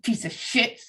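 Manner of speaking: mock angry mother through clenched teeth; imitated gritted-teeth scolding. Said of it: "grit her teeth and be like".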